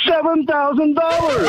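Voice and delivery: high, drawn-out announcement; excited reveal. Real, not acted. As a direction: announce the big win slowly and high. Caller reveals his free silver total.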